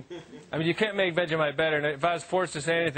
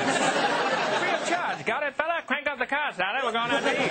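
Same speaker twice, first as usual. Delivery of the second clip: 1930s movie voice; 6 semitones higher than usual. Pretend. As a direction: ham it up like a thirties film star. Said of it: "speak in this nineteen thirties movie voice".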